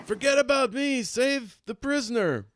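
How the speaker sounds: high, loud, urgent shout. Acted. Ernie orders the others to save the prisoner, shouting. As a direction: yell it out as an order. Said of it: "Ernie shouts".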